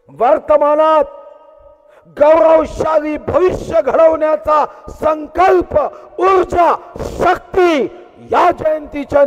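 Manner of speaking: building to a high, loud rallying cry; rousing oratory. Real, not acted. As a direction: lift the crowd, rising to a shout on ऊर्जा. Rousing climax of Shiv Jayanti speech.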